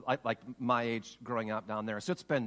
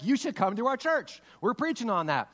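high, loud, excited exclamation; eager retold invitation; 10 semitones higher, 7 dB louder than usual. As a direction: pitch it like a jubilant invitation. Acts out eager invitation to church.

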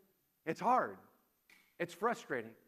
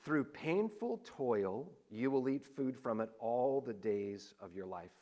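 solemn scripture reading; 6 semitones lower, 4 dB quieter than usual. Acted. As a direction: read the curse heavily, letting it fade to almost nothing. Reading Genesis curse aloud.

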